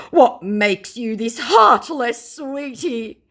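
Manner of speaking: slow, high put-on plea; mock scammer. Scammer trying to lure the target back, hammed-up plea. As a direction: stretch it out, softening on the last word. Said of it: "he responded with".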